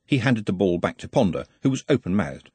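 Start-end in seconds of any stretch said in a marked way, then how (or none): none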